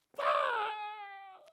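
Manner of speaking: sharp shouted braking noise; imitating brakes slamming on, high and loud; loud brake screech. Braking car, sudden stop.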